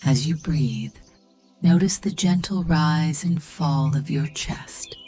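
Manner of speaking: plain reading voice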